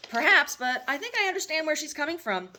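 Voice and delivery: a hint of a doting mother; mother's voice. Mother comforting her grown son, lightly voiced mom.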